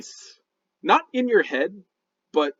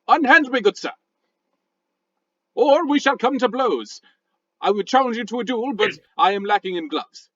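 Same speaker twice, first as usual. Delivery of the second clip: huffy posh British rock voice; indignant British gentleman scolding; a rock with a stuffy British accent; angry British; 3 dB louder than usual. Acted. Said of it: "The Rock says".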